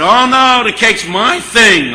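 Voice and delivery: high and loud, cake's my thing slowed; eager insistence. Retold or imitated. Diner owner claims the cake job, high and loud.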